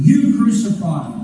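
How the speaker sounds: "you" punched out, phrase slowed down; stress on YOU. Acted. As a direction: lean accusingly on the word "you". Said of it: "is emphatic".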